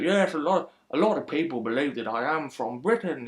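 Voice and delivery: lightly put-on British accent; half-hearted British accent impression; mock British accent. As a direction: imitate a fake British accent. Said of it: "puts on the greatest British accent ever".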